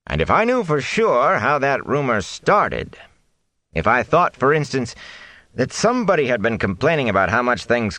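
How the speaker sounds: aim each word at the thatcher; pointed mayor. A narrator's reading. Bran pointed.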